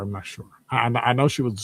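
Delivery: normal talking voice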